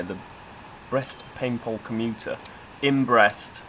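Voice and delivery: ordinary talk, nothing marked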